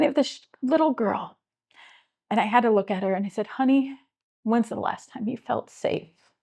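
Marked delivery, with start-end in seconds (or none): 3.5-6.3 tender, slow. Real, not acted. Gently asking a scared girl.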